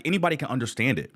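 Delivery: ordinary talk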